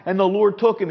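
ordinary talk, nothing marked

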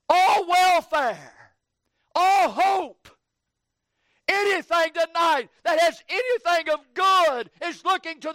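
full-force preaching. Acted. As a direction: build and pound every phrase. Sustained peak of the sermon.

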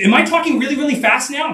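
high, agitated rush; talking fast, getting heated. Shown as a demonstration. Preacher demonstrating unnoticed anger, fast and high. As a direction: act worked up, pushing the pitch up. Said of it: "now I'm talking really".

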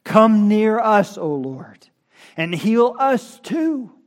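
slow, high plea building to loud cries; crying out in prayer. Acted. Preacher pleads to the Lord for the church, crying out. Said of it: "crying out to the top of our lungs".

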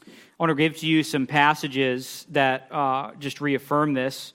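usual speaking voice

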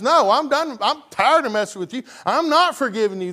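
a fed-up mother refusing; fed-up mom. Acted. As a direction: snap it out as an annoyed mother. Mom refusing forgiveness, acted.

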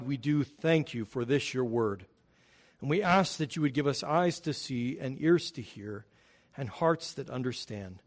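a hushed, reverent prayer voice. Acted. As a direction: pray it gently to God. Leads a prayer.

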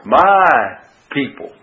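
long, slow, weighty "My"; stress on MY. Shown as a demonstration. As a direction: stretch "My" out, then land on "people". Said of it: "emphasis is upon the word "my".

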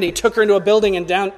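ordinary talk

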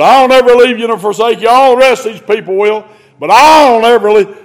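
high, fast, loud proud boast; boastful Peter. Retold or imitated. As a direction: brag you'll never leave. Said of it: "he said".

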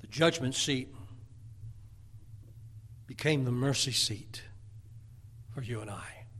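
low, soft, fading with long pauses; almost to yourself, very solemn; hushed, reverent preaching. Acted. Preacher on the mercy seat, hushed.

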